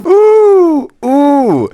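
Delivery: very high, loud squealing ooh; excited. Real, not acted. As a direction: squeal with delight. Reacting to the ick.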